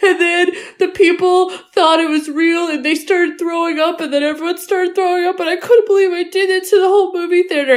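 high, fast, breathless babbling; rushed confession. Retold or imitated. Chunk confession continues.

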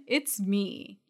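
ordinary talk

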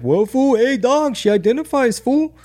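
high, hammed-up street slang voice; mock the woke crowd in a high homie voice. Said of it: "be like".

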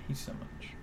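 breathy whispered aside; whispering. Acted. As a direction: whisper it under your breath. Repeats phrase as a whisper.

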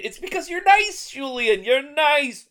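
high, loud mock-sweet teasing. Real, not acted. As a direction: needle your friend playfully. Teasing his co-host.

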